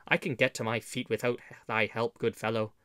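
ordinary delivery, nothing marked

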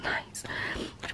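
whisper. Real, not acted. Private aside about her lunch.

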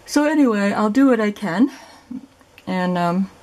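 talking through a yawn; words stretched by a yawn. Real, not acted. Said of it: "sorry I keep yawning".

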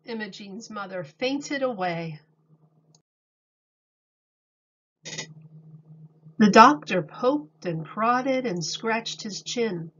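calm storybook reading voice; reading to children. A narrator's reading. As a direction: read it to a class of little kids. Reads picture book aloud.

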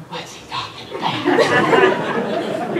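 imitating a puzzled kid, high and whispery; puzzled child. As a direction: whisper it like a baffled listener. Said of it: "Ellie's sitting up here going".

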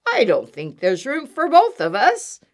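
loud, emphatic badger voice; barking badger. A narrator's reading. Badger refusing to share with Mouse, loud bark. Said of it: "barked Badger".

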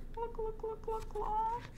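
very high, soft clucking hen; sleepy hen cluck. Acted. Hen clucks good night, very high and soft. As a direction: cluck softly like a drowsy hen.

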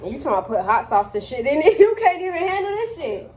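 laughing. Real, not acted. Teasing friend during spicy challenge.

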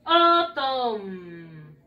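"Autumn" drawn out as OOH-tommm, falling; pronunciation model. Shown as a demonstration. Teacher has pupils repeat after her, "Autumn" as slow OH-tom. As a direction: stretch "Autumn" from a long o down to a held m.

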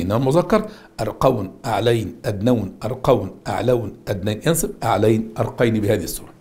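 ordinary talk, nothing marked

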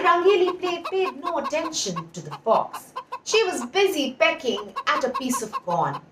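usual speaking voice

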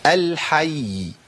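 الحي very slow, final ya drawn out; final ya held double. Shown as a demonstration. Teacher giving an example of stopping, الحي with ya held long. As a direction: stretch the closing y of الحي for two letters. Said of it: "بقف عليه بزمن الحرفين".

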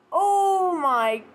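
high breathless exclamations; reeling from the shock; stunned. Real, not acted. Reacting to a jump scare.

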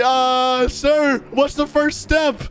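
high, urgent, stretched-out question; urgent nervous question. Pretend. Recruit asking the chief as fire starts, nervous. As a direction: call for instructions in a high, anxious voice.

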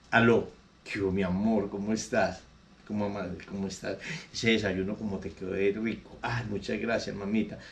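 husband sweet-talking his lover on the phone, low and soft. Acted. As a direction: murmur sweet nothings down the phone, then ask for the wife. Cheating husband calls home.

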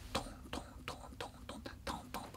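fast breathy "トントン" for feet rushing downstairs; fast whispered footsteps. Retold or imitated. 階段を降りる子供の足音. (速いささやき声の足音)

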